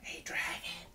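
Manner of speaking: hushed, breath-only call; soft whisper. Acted. Elizabeth tests the sleeping dragon, whispering very softly. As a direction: whisper it very gently. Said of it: "Elizabeth whispered very softly".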